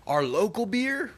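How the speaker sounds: high, slow, impressed voice; mock-amazed praise of the local beer; impressed coworkers. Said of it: "they were like".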